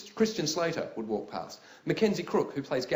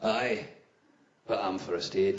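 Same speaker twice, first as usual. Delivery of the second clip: low, deliberate hard-man Scot; deep, unbudging tough guy; tough first-aid guy; 8 semitones lower, slower than usual. Acted. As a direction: stand your ground in a deep voice. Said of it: "toughest first aid guy I've ever seen".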